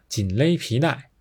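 usual speaking voice

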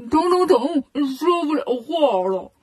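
talking through a stung, swollen lip; lightly mimed numb-mouth speech. Acted. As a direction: mumble as if your lip is puffed up. 八戒嘴被扎肿.

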